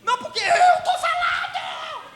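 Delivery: high, slow mock shout; hammed-up shouting spouse, laughing; mock loud arguer. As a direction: imitate someone raising their voice to win. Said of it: "Se você tem a impostação de voz maior".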